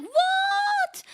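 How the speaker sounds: very high, loud stunned what; shocked what. Retold or imitated. Streamer hearing Chihaya designed the bike, loud high shock. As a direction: squeal it in disbelief. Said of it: "I was like".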